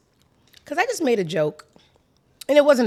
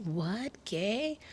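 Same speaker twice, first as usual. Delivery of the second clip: mock surprise; 9 dB quieter than usual. Mock reaction, quiet.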